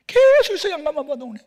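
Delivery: a father's loud frustrated outburst at grades; exasperated yell. Retold or imitated. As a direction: blow up at the report card. Frustrated father over bad grades.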